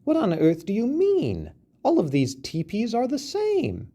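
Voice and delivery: high, squeaky mouse voice in disbelief; a tiny mouse, astonished; flabbergasted mouse. A narrator's reading. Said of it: "The mouse was flabbergasted".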